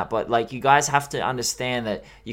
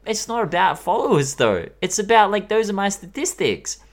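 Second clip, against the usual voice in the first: raised, insistent retort; exasperated protest; 7 semitones higher, 3 dB louder than usual. Retold or imitated. Retelling his retort to the account, raised and insistent. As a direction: push back, pitched up, a bit louder. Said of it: "And I was like".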